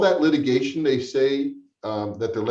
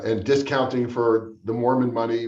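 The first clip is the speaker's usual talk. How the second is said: ordinary talk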